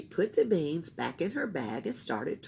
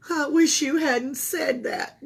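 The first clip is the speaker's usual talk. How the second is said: groaned; 8 semitones higher, 6 dB louder than usual. A narrator's reading. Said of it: "groaned Camilla".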